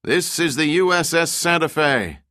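high, projected ship's hail; loud announcing voice. A narrator's reading. Unseen ship welcomes stranded men through fog, loudly announcing. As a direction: announce it as if over a loudhailer. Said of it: "a loud voice, electronically amplified to thundering proportions".

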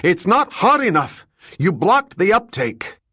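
high, loud angry yelling; yelled. Acted. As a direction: keep screaming at him in fury. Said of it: "yelled the foreman".

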